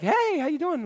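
high, soft, overly friendly hello; bluffing cheer on the phone; fake-friendly phone greeting. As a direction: act like you know who's calling. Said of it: "you answer the phone like".

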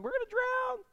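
high, squeaky mock panic; mock scared scream. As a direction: squeal it like terrified disciples. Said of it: "screaming and yelling".

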